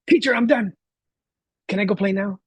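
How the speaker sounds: high-pitched mimicked child; eager little boy. Child telling teacher he's finished with his colors, eagerly imitated. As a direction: imitate an excited kid announcing he's finished.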